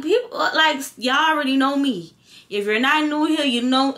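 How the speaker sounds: retold irate rant. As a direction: wind up to telling them off. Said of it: "I did get a little bit irate".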